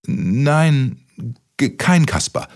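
high, frightened stammer; stuttering. Acted. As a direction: stumble over the words in fear. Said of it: "stotterte Kaspar Mütze".

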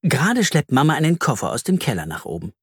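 ordinary delivery, nothing marked

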